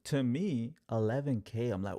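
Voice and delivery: usual speaking voice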